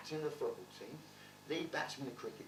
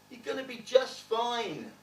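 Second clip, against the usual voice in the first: slower, gentle repeat; slow reassurance; 8 semitones higher, 7 dB louder than usual. Retold or imitated. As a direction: say it again slowly to calm him. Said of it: "big hug, said".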